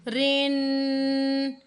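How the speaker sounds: रिन with the nasal hum held a second; nasal ghunna. Shown as a demonstration. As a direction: hold the नून in रिन through the nose. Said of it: "नाक के अंदर आवाज़ को ठहराकर". (रिन पर गुन्ना)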